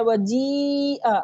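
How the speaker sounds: slow "वजीअ" with long jee; jeem joined to ya. Shown as a demonstration. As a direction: stretch the ee of "वजीअ", alif silent. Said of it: "जीम को या से मिला रहे हैं".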